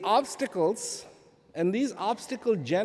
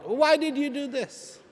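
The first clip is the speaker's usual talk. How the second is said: a higher, louder mock complaint to God; imitating anger at God; 10 semitones higher, 5 dB louder than usual. Believer in the sermon angrily complaining to God, imitated. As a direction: play the wronged believer shouting upward. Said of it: "angry at God".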